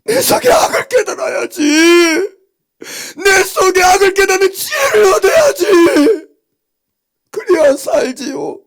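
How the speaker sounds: sobbing sermon climax; crying preacher shout. Real, not acted. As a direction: plead with tears in the voice.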